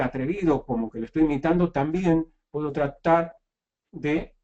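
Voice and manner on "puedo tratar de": halting, with gaps between word groups; slow start, stopping before and after "de"; broken-up words. Shown as a demonstration. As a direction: pause mid-phrase to demonstrate choppy speech. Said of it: "cortar un poco las palabras".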